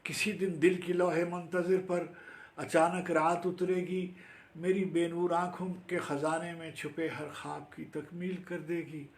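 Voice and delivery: impassioned Urdu recital; poetry recitation. Acted. Recites own Urdu poem.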